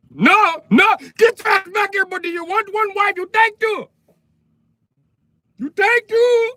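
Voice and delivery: put-on high, loud Indian accent shout. Indian husband yelling at the man to take two wives, high loud Indian accent. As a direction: yell it after him, high and pushy in an Indian accent.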